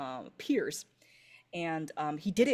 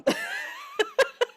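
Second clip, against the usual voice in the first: high loud laugh; real laugh; 8 dB louder than usual. Real, not acted. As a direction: laugh off the French accent. GM laughs at own accent remark.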